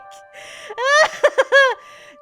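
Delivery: high, loud peal of laughter; giddy shrieking laugh. Real, not acted. Player overcome by the romantic scene, shrieking with laughter. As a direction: squeal with helpless laughter.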